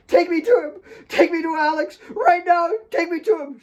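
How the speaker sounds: high, loud, crying demand; shrill desperate shouting; frantic demand. A narrator's reading. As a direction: tear the words out of her. Kate grabbing Jamina's hair.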